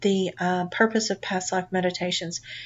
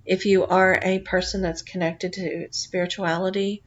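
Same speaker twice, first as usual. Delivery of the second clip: calm guided relaxation read; guided meditation. Acted. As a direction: lull the listener gently into the journey. Leads a guided past life meditation.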